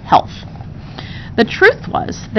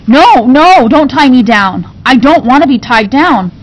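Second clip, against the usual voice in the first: loud, high frightened protest; panicked pleading; 4 semitones higher, 6 dB louder than usual. Acted. Frightened girl pleads with the servants, loud and high. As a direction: cry out against being tied. Girl fears being tied.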